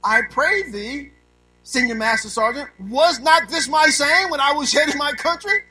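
hammed-up old-English plea, loud and high; mock King James plea. Preacher voicing Greg's old-English complaint, hammed up. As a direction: plead in mock Bible speech. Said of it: "Greg said something like this".

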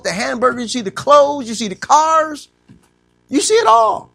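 preaching at full force, rising. Acted. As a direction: preach it big and build. Preaching, building up.

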